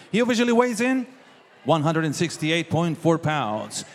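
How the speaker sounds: ordinary delivery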